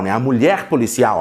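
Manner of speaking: ordinary talk, nothing marked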